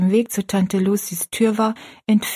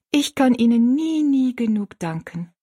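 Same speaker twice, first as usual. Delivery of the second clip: put-on higher, heartfelt girl's voice; moved; 5 semitones higher than usual. A narrator's reading. Girl embracing the old woman, deeply moved. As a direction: pour out thanks, pitched high then easing. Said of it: "sagte Rosalie bewegt".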